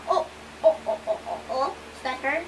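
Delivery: usual speaking voice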